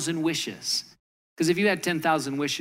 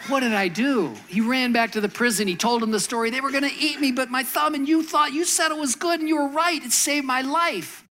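much higher and louder, tumbling out; excited king; 9 semitones higher, 4 dB louder than usual. Retold or imitated. King blurting his rescue story to the jailed servant, high excited. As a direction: gush it out like a man just saved. King rushes back, relieved.

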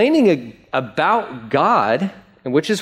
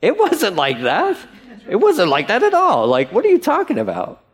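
laughing disbelief, rising higher; chuckling "it wasn't like that"; laughing protest; 5 semitones higher than usual. Retold or imitated. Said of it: "and you're like".

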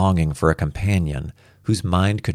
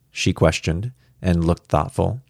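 usual speaking voice

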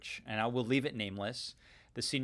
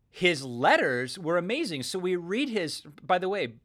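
normal talking voice

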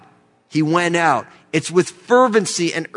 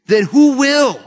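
raised, louder rhetorical challenge; urgent challenge; 7 semitones higher, 5 dB louder, slower than usual. Real, not acted. Preacher urging us toward a sense of urgency, urgent challenge. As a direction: push the question up high and loud.

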